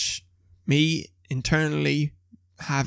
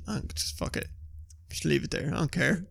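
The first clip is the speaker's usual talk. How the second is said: indifferent dog; 9 dB quieter than usual. Acted. Voicing his own indifferent dog.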